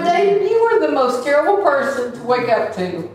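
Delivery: mock-exasperated wife teasing her husband. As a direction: scold him half laughing in exasperation. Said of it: "I'm going".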